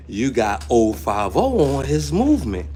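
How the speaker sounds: usual speaking voice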